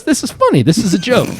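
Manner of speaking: high-pitched incredulous imitation; disbelieving prank victim. One guy deciding the call is a prank, high and incredulous. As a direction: play someone catching on to a joke.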